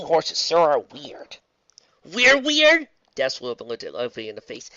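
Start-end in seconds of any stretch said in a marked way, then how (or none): 2.0-2.9 indignant. Acted. Dash indignant.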